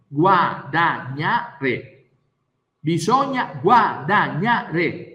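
Guadagnare drawn out slowly; slow emphasis. Shown as a demonstration. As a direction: hammer Guadagnare slowly and heavily. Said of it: "slow emphatic repetition".

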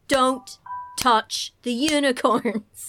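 "don't touch the unicorns" slow, word by word; emphatic rule. Acted. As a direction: pause before "touch", stretch "unicorns". States forest club rule emphatically.

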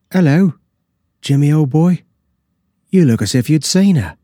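a servant calling out in surprise; footman's exclamation. A narrator's reading. Footman greets the pale Hegan, loud and high-pitched.

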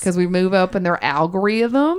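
put-on Southern accent. Host begs listeners for reviews in a Southern accent. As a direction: plug the show in a Southern accent. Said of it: "in my Southern accent".

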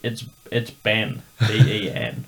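Ben spelled slowly and deliberately; slow spelled-out clarification. Retold or imitated. Retelling how he slowly spelled out Ben for the singer. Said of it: "I'm like".

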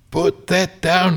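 high, slow alarmed shout. Acted. Old carver shouting at the child with the razor blade, high and alarmed. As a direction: yell it like a scared old man. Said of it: "he shouted".

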